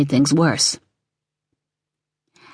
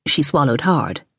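ordinary delivery

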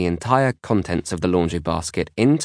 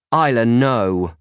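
slow, louder, higher telling-off; scolding; 4 semitones higher, 5 dB louder, slower than usual. A narrator's reading. Big sister to Isla over torn book, scolding. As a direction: draw out a firm reprimand. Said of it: "I scold".

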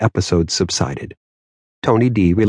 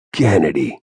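lower, no feeling at all; emotionless; 4 semitones lower than usual. A narrator's reading. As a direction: say it like it means nothing to you. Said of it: "without the slightest trace of emotion".

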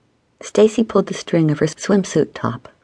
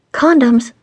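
high, louder interjection; interjecting; 7 semitones higher, 5 dB louder than usual. A narrator's reading. Stacy breaking into the rules talk, interjecting. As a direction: cut in abruptly with a raised voice. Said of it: "Stacy interjected".

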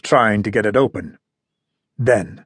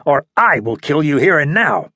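threatening; 3 semitones higher than usual. A narrator's reading. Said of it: "I demanded".

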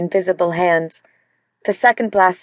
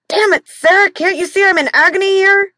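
much higher, loud, exasperated snap; angry outburst in pain; 13 semitones higher, 4 dB louder than usual. Acted. Injured husband snapping at his wife in agony, angry and high. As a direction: lash out from the pain.

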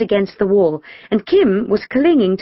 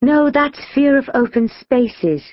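slow, higher know-it-all correction; authoritative; 6 semitones higher, slower than usual. A narrator's reading. Gina corrects Shirelle on phobias, authoritative. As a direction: correct them with measured authority. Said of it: "Gina replied authoritatively".

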